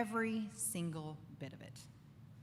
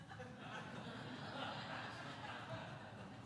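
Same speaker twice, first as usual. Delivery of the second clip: soft breathy laugh; 13 dB quieter than usual. Real, not acted. Laughs at own joke.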